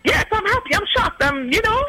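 high-pitched, flustered delight; excited retelling. Retold or imitated. Customer answers bank teller about surprise credit card, high excited retelling. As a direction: re-enact being happily shocked.

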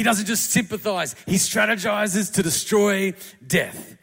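loud, rising pulpit cry; full-force preaching. Acted. Preacher rallying the congregation with Jesus as a war horse, loud and fervent. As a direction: build it like a battle charge.

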